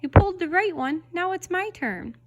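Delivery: high and loud, then dropping off; pigtail demanding. A narrator's reading. Pigtail demanding its turn from Paul, high-pitched. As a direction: insist it's your turn.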